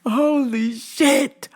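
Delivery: slow, high disbelief; stunned. Real, not acted. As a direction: let it out slowly in shock. Stunned exclamation.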